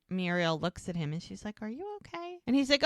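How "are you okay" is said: high, very soft concerned question; imitated tender worry; gentle concern. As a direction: ask softly, pitched way up, as if checking on him. Retold concerned question to man.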